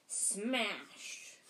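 SMASH drawn out for impact; dramatic crash word. A narrator's reading. Narrator as the door is hit, a dramatic, drawn-out "smash".

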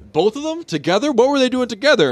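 high, rising disbelief; can't believe what he hears; mock shocked [name].

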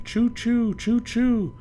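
high choo choo; train choo choo. Acted. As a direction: sound like a train. Imitating a train.

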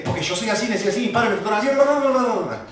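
rattled-off rant rising into blah-blah; rushed dismissive mimicry; mocking excuses. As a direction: parody someone listing tired excuses.

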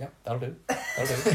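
a lightly voiced, repetitive murmur breaking into a laugh; half-laughing imitation of a dazed patient; Hodor-like mumble. Retelling a ward neighbour to friends, a mild mock mumble. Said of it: "He sounds like, ... Game of Thrones?".

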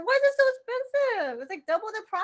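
loud, high outraged disbelief; incredulous. Retold or imitated. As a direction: protest the price, high and loud. Retelling shock at mailing price.

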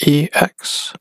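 "E X" said letter by letter; spelled out. Real, not acted. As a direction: spell out "E X" as two separate letters. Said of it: "I'm just spelling it out".

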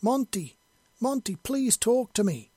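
high, loud, desperate call; pleading yell. A narrator's reading. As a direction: call through a door, begging him to answer. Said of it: "she yelled".

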